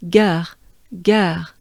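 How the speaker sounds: "geur" ending in a French R. Shown as a demonstration. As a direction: demonstrate "geur" as a separate syllable, tongue down for the R. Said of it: "here it's part of".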